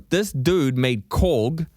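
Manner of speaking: ordinary talk, nothing marked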